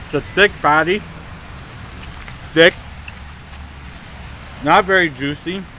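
chewing while talking; talking between bites; mouth full. Real, not acted. Seen 0: Bites burger at -2, chews and talks through labelled words.

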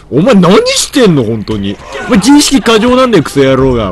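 loud, high hammed-up scolding of a game character; mock anger. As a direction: yell at him in playful fake rage. Game character called police on him. (ゲームの相手に大声でふざけて怒鳴る)

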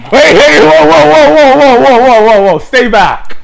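high, loud panicked yelling; panicked shouting. Acted. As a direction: yell at her to back off. Vampire comes closer again.

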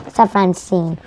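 ordinary delivery

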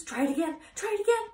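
a goose shrieking orders at the pig; frantic goose. A narrator's reading. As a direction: goose yelling at Wilbur to dodge the chasers. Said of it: "cried the goose".

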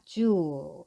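주 with the "oo" stretched out; long-vowel reading. Shown as a demonstration. Teacher models the word zoo, 주 drawn out. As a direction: say 주 as one long syllable. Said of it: "이렇게 발음하시면 되고요".

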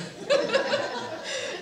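high bright burst of laughter; a short laughing outburst. Real, not acted. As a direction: laugh at the memory. Laughing at memory.

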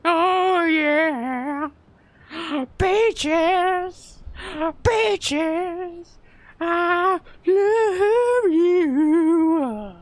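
put-on very high, drawn-out drunken croon; swooning falsetto. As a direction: stretch each word high and slow, lovestruck. Drunk man swooning over peaches.

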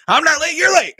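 high, loud comic comeback; jokey high-pitched outburst; mock indignant shout. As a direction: yell it back in playful protest. Repeats the line as mock outburst.

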